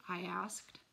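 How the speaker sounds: ordinary delivery, nothing marked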